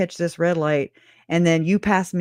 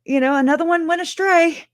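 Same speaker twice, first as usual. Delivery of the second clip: mock sigh; 11 semitones higher than usual. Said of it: "we're like".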